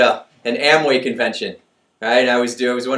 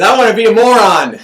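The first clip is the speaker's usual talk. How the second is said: laughing punchline; 8 semitones higher, 11 dB louder than usual. Acted. Joke punchline, kid's answer.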